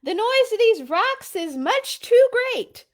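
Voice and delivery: loud, high shout; shouting Papa. Acted. Papa yelling over the noise of the rocks, loud shout. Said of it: "shouted Pa".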